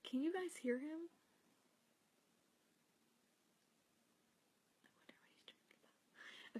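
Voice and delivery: hushed so the dreaming cat stays asleep; soft breathy whisper. Real, not acted. Whispering to viewers beside her sleeping cat.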